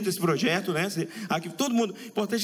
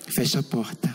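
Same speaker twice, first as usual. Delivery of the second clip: lower, voiced, matter-of-fact request; low doctor voice; 6 semitones lower, slower than usual. Doctor to patient, low voiced request. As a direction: ask it plainly from a lower register. Doctor asking to close door.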